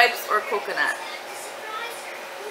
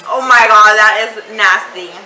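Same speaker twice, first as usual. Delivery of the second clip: loud disgust; 8 dB louder than usual. Real, not acted. Tasting gross bean.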